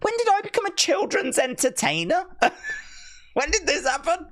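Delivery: high, exasperated squeak of disbelief; mock despair. Streamer to chat, suddenly playing kids' host, mock despair. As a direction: wail it up high in mock horror.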